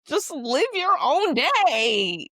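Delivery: high, drawn-out exasperated holler; exasperated outburst. Real, not acted. Podcaster ranting about holiday greetings, exasperated outburst. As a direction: let the frustration burst out, slow and high. Exasperated rant, then apologizes.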